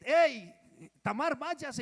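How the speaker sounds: the preacher voicing Amnon sending Tamar away, loud and harsh; harsh dismissal. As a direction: bark it out like a brother driving her away.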